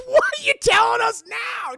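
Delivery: shocked parents yelling; alarmed shout. Retold or imitated.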